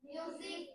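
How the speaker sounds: মিউজিক with the s said as a z; মিউজিক said clearly with a voiced z; z sound in middle. Shown as a demonstration. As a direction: answer মিউজিক with a buzzing z inside. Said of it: "কি মিউজিক না মিউজিক".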